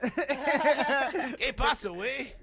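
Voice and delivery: put-on slow laughing Mexican accent; drawn-out Mexican accent. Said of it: "That's a Mexican accent".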